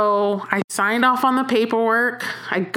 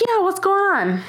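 much higher, clueless "Yeah, what's going on?"; oblivious chirp; 12 semitones higher than usual. Retold or imitated. Answering the serious admin, a clueless high chirp. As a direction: play it innocent and unaware. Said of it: "So I'm like".

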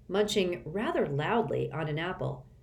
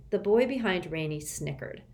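plain reading voice

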